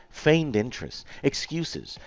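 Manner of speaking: ordinary talk, nothing marked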